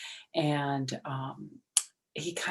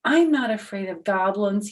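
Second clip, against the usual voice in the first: brave; 5 semitones higher, 8 dB louder than usual. Acted. Herschel boasts bravely.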